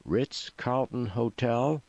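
restrained shout from a puzzled driver; mild shout. A narrator's reading. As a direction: call it out slowly, rising at the end. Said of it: "shouted the driver".

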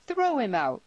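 loud, high-pitched command; heated call to eject him; angry order. A narrator's reading. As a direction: order it with indignant force. Tierney orders Cameron thrown out.

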